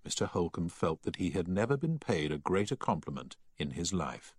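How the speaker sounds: ordinary delivery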